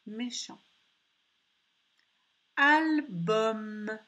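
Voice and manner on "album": "Album" loud, in two stretched syllables; al, then bum, held out in "Album"; word sounded out. Shown as a demonstration. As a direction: project "Album" slowly with a long m. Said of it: "c'était le son M".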